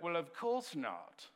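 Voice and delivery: put-on slow, snooty British accent; mock British. Pastor mocks his religious friend with a slow, haughty British accent. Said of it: "he was like".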